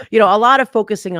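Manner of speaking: quick, loud burst of laughter; laughing. Real, not acted. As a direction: laugh it out, rushing the words. Bursts out laughing about the case.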